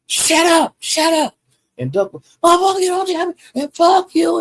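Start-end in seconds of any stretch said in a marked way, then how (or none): 0.1-1.4 high, sharp retold shut up; imitating a yell at a far higher pitch; mock shout. Retold yelling down the rival. 2.4-4.4 high, hammed-up cursing back; retold fury at a much higher pitch; mock cursing. Retold cursing reply, Duck.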